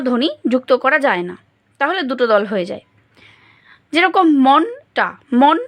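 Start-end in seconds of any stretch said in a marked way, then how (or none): 4.5-5.6 "মনটা" slowly as মন, pause, টা; syllable by syllable. Shown as a demonstration. Teacher separating closed and open syllable, "মনটা" syllable by syllable. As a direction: split "মনটা" into two beats. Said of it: "এটা রুদ্ধ দল এবং টা হচ্ছে".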